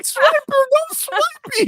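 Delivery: very high squeaky scold; mock scolding. Others scolding Jim, very high mock squeal.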